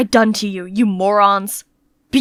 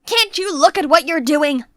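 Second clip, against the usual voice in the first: higher, sharp angry scolding; 8 semitones higher than usual. Acted. Master scolding the maid over spilled tea, angry. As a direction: tell off the clumsy maid.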